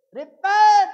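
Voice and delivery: loud preaching cry of Repent; shouted Repent. Acted. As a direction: call out Repent like a prophet. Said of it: "he said".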